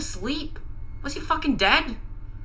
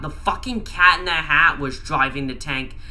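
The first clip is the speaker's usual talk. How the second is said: ordinary delivery, nothing marked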